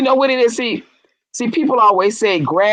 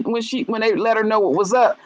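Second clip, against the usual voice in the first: ordinary talk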